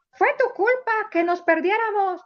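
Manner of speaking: lightly voiced child blaming his friend; child's voice. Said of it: "y le decía".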